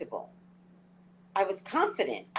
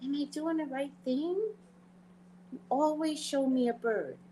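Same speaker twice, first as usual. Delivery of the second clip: pleading prayer; 8 semitones higher than usual. Retold or imitated. Asking God for a sign before her trip, in a high, pleading voice. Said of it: "and I go".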